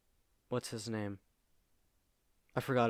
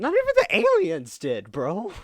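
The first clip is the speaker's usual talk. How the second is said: incredulous; 5 semitones higher, 5 dB louder than usual. Real, not acted. Outraged aside.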